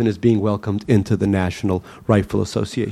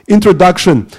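put-on boyish, higher voice with a Russian accent; Russian-accented boy reading; 7 semitones higher, 6 dB louder than usual. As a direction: read your own title proudly, Russian accent. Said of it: "my strange new English voice".

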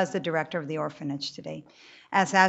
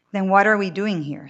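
blunt; 3 semitones higher, 9 dB louder than usual. A narrator's reading. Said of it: "asks bluntly".

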